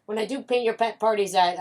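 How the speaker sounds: normal talking voice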